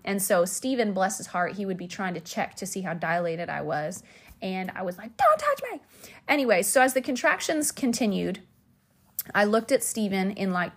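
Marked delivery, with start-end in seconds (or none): 5.2-5.8 very high, quick mock warning; squeaky-high retold command; retold labor outburst. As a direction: imitate yourself fending him off in labor. Retold snapping at husband in labor.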